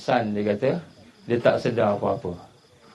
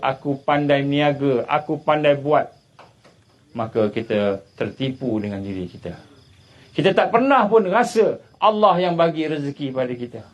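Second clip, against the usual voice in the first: ordinary delivery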